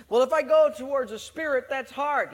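mock whine. Flesh resisting the Spirit.

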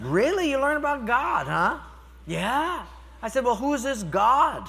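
teasing, high doubtful questions; mock doubt. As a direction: play devil's advocate with a child. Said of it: "play the devil's advocate".

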